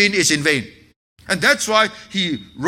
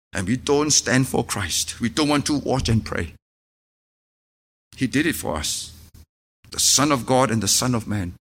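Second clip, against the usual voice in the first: low, soft, earnest preaching; intimate, solemn pulpit voice; hushed sermon; 9 semitones lower, 4 dB quieter than usual. Acted. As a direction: draw the congregation in quietly.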